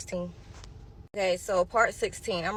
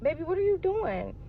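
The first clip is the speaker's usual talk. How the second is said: high, gentle nurse voice, raised pitch; sweet nurse; 9 semitones higher, 3 dB quieter than usual. Retold or imitated. Nurse gently asks what the patient is doing, high and sweet. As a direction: soothe a patient like a kind nurse. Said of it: "the nurse comes back in and she was like".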